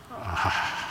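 soft low mock "aww" from the women; hushed swooning aww; touched aww. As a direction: sigh out a melting "ah". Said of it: "And every woman goes".

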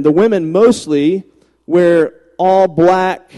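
usual speaking voice

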